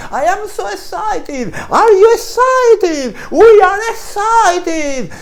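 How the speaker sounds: very high, slow, loud gushing excitement; overexcited tour guide. Retold or imitated. Teacher imitating a bubbly American tour guide, high and gushing. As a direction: ham up a thrilled tour guide. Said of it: "los guías decían".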